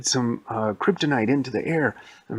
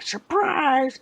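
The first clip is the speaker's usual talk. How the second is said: very high-pitched, sprung "surprise"; gleeful old lady reveal; 4 dB louder than usual. Acted. Old lady springing kung fu on police, high-pitched reveal.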